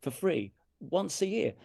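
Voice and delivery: slow, high, indignant self-questioning; real anger. Real, not acted. Musician asking himself why he never played free, quite angry. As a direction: demand it of yourself, drawing out each phrase. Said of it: "I get quite angry now".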